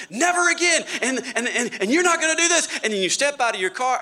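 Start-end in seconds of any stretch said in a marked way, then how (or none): 0.1-2.7 furious, much higher and louder; yelling. Retold or imitated. Parent yelling at family on way to church, furious shout.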